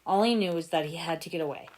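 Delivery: plain reading voice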